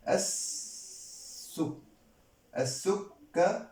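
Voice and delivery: long held S in السكر: asss-suk-ka-ru; doubled S. Shown as a demonstration. Teacher showing the sun letter in السكر, S held long. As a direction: break السكر into slow syllables after a long S.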